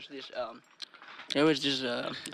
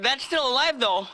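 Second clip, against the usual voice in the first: mock alarmed; 9 semitones higher, 7 dB louder than usual. Quote of teller.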